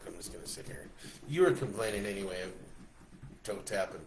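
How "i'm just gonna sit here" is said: soft sulky murmur; mock sulk. Real, not acted. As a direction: pretend to sulk, quietly. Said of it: "mock sulking".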